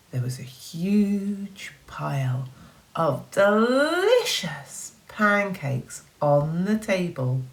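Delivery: ordinary delivery, nothing marked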